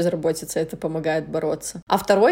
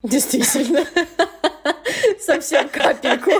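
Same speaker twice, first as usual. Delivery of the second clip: giggling through the words; amused laughing reply; 6 semitones higher than usual. Real, not acted. Laughing at death remark.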